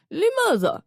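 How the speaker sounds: high-pitched, confrontational question; challenging disbelief. Acted. Confronting two visitors over who is master, challenging. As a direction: throw the question at them, incredulous.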